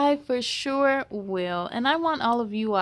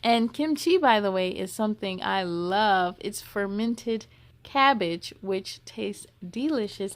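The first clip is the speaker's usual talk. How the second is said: ordinary delivery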